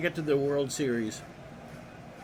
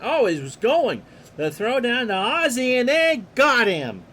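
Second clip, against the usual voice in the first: higher and louder, caught up in the play; excited call; 7 semitones higher, 6 dB louder than usual. Acted. Announcer reacting as the runner is thrown out, excited. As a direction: sell the pickoff like a big moment.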